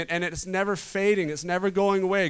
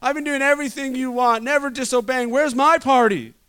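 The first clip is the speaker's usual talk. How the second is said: higher and louder, complaining about being overlooked; hammed-up resentful son demanding his reward; indignant older brother; 7 semitones higher, 6 dB louder than usual. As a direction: whine that you've earned your party. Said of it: "He's like".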